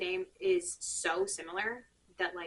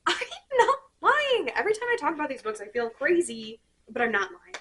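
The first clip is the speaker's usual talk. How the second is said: high, loud, laughing protest; laughing insistence; 6 semitones higher, 8 dB louder than usual. Real, not acted. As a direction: insist you're not making it up, amused. Laughing insistence she's truthful.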